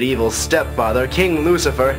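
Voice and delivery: usual speaking voice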